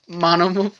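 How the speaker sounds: ordinary talk, nothing marked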